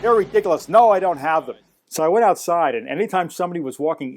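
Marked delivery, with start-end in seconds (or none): 0.0-1.6 high, loud scornful refusal; imitated irritated dismissal. Shop owner refusing Pink Panther films, imitated scorn. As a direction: brush off the request in mock outrage.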